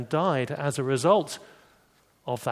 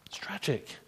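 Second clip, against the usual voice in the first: whispered; 10 dB quieter than usual. Real, not acted. Preacher's sombre aside.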